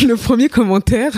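high, laughing through the words. Real, not acted. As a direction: try to start the story while cracking up. Cracks up before telling the comment. (fou rire en parlant, voix aiguë)

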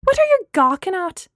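loud, high, indignant challenge; angry shout. A narrator's reading. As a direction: shout it at staring strangers. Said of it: "Gemma shouted at them".

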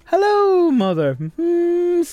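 very high "Hello" dropping to "mother", then a high "Mm-hmm"; mock movie line. Repeating the presenter's funny movie line, high and camp. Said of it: "the way he said it was very funny".

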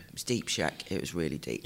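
low voice quoting Donkey; deep Shrek line, Donkey style; Donkey impression. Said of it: "That's what Donkey says".

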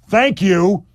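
loud, high, exasperated thank you; annoyed thank you. Retold or imitated. Deputy mayor fed up with the mayor, annoyed and loud. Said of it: "With annoyance".

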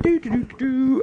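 high sing-song doo-doo-doo; carefree humming. Retold or imitated. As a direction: trill idly as if unbothered. Imitates woman sitting unbothered.

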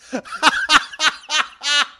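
real high-pitched giggling fit; high laugh. Real, not acted. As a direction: burst out in loud high giggles. Amused by the question.

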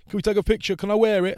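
high, loud pleading voice of an excited fan; eager fan. Retold or imitated. Fans crowding the comedian for a photo, high eager pleading. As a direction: beg for the photo like an overexcited lad.

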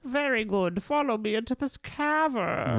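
high, theatrical dungeon guide; lightly voiced mysterious guide; hooded guide voice.

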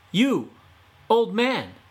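put-on Fox hails the old man, high and loud; calling out. Fox calling up to the painter, loud and high. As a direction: call up to him brightly.

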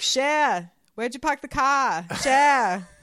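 a Queens uncle yelling across the house; New York accent. Retold or imitated. Uncle shouting to Cher in his Queens accent. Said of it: "Let me see if I can do my unc".

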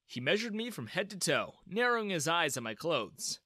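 ordinary delivery

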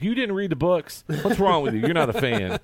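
high-pitched, loud mock telling-off; retold yelling. Fan berating the girl beside him for not reading the books, retold yelling. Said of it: "Why are you yelling at this girl".

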